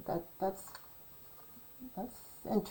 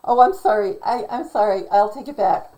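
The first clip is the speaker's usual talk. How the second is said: loud, sudden self-correction; flustered apology; 10 dB louder than usual. Real, not acted. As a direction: blurt a loud, embarrassed apology. Catches own mistake, apologizes.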